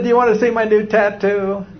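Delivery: imitated excited woman, high voice. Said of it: "She's all excited".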